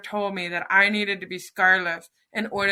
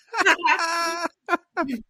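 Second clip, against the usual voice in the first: high laugh near tears; emotional laugh; 8 semitones higher than usual. Real, not acted. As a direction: laugh through happy tears. Laughs after her cheeky line.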